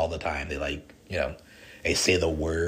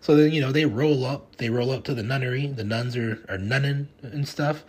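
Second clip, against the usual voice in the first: usual speaking voice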